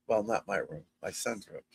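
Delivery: dropped, murmured correction; quiet aside. Real, not acted. Talking to viewers, muttering a quiet correction about the room. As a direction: say it under your breath.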